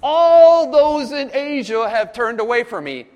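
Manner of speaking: high, loud, slow lament; Paul's overstated grief; emotional lament. Retold or imitated. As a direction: read it as a hurt complaint. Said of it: "Look at what Paul says".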